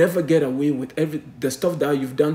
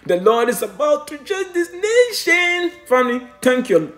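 raised, louder pulpit voice; fiery preaching; 9 semitones higher, 4 dB louder than usual. Acted. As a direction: preach it hard to the congregation. Preaching at full force.

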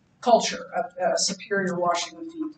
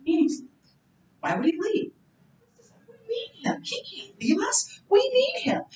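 higher, anxious inner voice of Peter; Peter's worried thoughts; 9 semitones higher, 4 dB quieter than usual. Retold or imitated. Pastor voicing Peter's panic in his head, higher and anxious. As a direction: let Peter fret to himself, rising. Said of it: "He's like".

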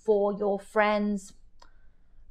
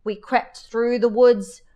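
ordinary delivery, nothing marked

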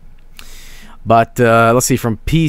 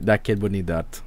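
normal talking voice

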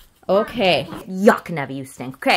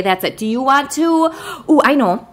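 usual speaking voice

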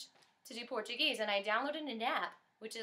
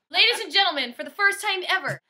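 higher and louder show-host call; announcer voice; 6 semitones higher, 11 dB louder than usual. Acted. Singer announcing the song to the audience like an emcee.

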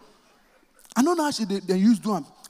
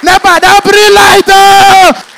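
loud high pleading wail; crying shout; 9 semitones higher, 11 dB louder, slower than usual. Retold or imitated. Child wailing not to be beaten. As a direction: beg through tears.

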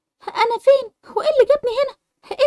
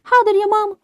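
usual speaking voice

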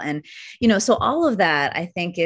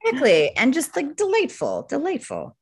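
high, swooning delight; 10 semitones higher than usual. Real, not acted. Guest agreeing about radio plays, high and delighted. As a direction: let the voice float up with joy.

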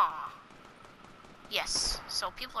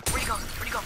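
ordinary talk

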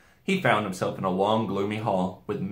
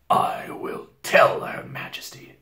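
slow, breathy growl; growling wolf; 4 dB louder, slower than usual. A narrator's reading. Wolf agreeing to report to his queen, growling slowly. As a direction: draw it out as a rough growl. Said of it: "said in a growling voice".

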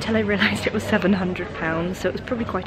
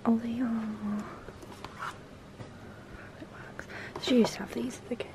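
hushed whisper among the shelves; shop whisper; 10 dB quieter, faster than usual. Real, not acted. Browsing a quiet homeware shop, hushed whisper.

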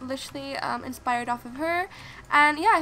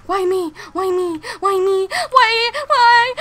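louder and higher with each why; wailing despair; 5 semitones higher, 11 dB louder, slower than usual. Real, not acted. Gamer wailing as she loses, rising louder. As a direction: wail it to the sky.

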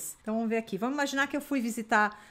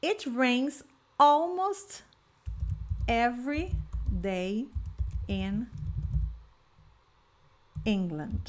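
slow, deliberate teacher modelling; slow model sentence; much slower than usual. Shown as a demonstration. Teacher modelling slowly for Brazilian students, deliberate. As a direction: spell it out slowly for the class. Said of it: "É só você ir agregando vocabulário".

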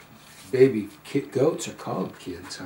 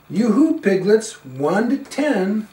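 high, louder call to the piglets; 6 semitones higher, 7 dB louder than usual. Acted. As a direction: sing out to the piglets. Calling to piglets.

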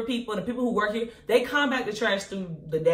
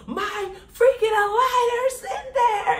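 much higher, laughing half-cry; hammed-up wail over the lost aligners; mock wail; 13 semitones higher, slower than usual. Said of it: "And I was just like".

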